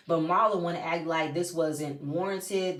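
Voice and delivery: normal talking voice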